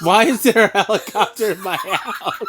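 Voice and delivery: laughing in disbelief; can't stop laughing at it. Real, not acted. Dad laughing at helicopter.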